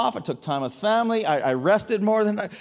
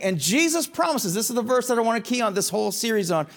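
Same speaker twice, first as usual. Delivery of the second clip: ordinary delivery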